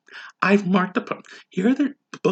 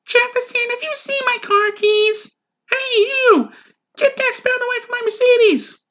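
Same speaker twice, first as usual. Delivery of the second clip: very high mock woman's voice; falsetto woman. Rich wife calling her husband, high falsetto. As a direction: shriek it high like a rich lady.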